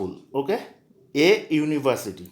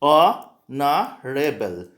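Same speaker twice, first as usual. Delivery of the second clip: slow model pronunciation; much slower than usual. Shown as a demonstration. Teacher drilling students on pronunciation, very slow and clear. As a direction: stretch honourable out very slowly, every syllable clear. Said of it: "इसके pronunciation से ध्यान दीजिए".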